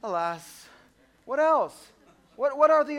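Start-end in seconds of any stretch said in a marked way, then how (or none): 0.0-0.7 "Alas" in a put-on antique stage voice; Old English lament. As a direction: sigh "Alas" like a tragic old player. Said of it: "if you're in Old English times".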